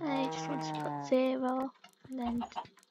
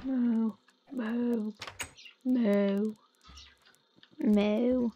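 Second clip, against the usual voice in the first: low, drawn-out moos; cow mooing; 4 semitones lower, much slower than usual. Pretend. Player mooing along with the game's cows, playful imitation.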